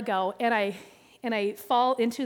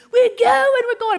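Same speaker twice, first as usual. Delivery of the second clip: high, loud whoop of setting off; excited cheer; 10 semitones higher, 8 dB louder than usual. Acted. Rafters setting off after their little cheer, excited shout.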